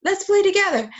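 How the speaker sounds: put-on high, loud cheerful child voice; a pretend child happily saying yes; child's reply. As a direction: squeak it out like an eager little kid.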